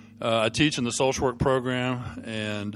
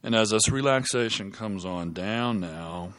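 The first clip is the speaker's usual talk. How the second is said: soft spa whisper; 3 semitones lower, 4 dB quieter than usual. Acted. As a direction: lull them down into deep calm. Leads a guided relaxation.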